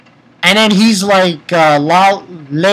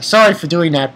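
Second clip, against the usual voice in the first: ordinary talk, nothing marked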